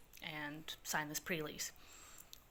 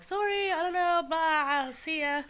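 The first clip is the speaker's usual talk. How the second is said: high, loud, drawn-out holler; hammed-up loud goodbye; breezy brush-off; 11 semitones higher, 9 dB louder, slower than usual. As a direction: call it out far up in pitch while escaping. Brushing off sister's complaint from afar.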